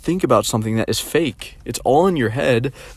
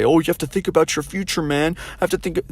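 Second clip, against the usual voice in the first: higher sing-song mock of adults; mocking lecturers; 6 semitones higher than usual. People belittling him about his future, mocking higher voice. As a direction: parrot the preachy advice back. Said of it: "try to belittle me and say".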